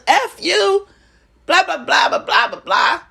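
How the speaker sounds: high-pitched mock shouting at a partner; mock yelling match. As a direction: ham up a silly yelling match. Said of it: "go into this".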